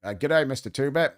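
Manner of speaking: low, quiet Australian greeting; mock Australian accent. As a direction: put on an Aussie accent, softly. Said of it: "oh, he's Australian".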